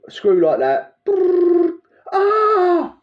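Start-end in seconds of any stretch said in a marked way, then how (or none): 1.1-1.7 high buzzing drill sound; drill noise. Retold or imitated. Mimicking the drill for listeners, high buzzing brrr. 2.1-3.0 sharp high cry of pain; mock yelp of pain. Acting out the worker hurting himself, loud high yelp.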